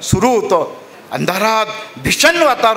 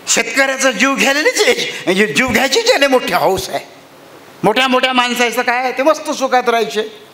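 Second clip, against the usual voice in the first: a touch higher, rueful laugh; wife agreeing bitterly; 6 semitones higher than usual. Acted. Wife bitterly agrees with her husband. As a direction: share his bitterness.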